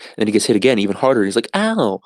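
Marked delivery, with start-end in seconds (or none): 1.5-2.0 yelp of pain. Retold or imitated. Jake hit with ruler.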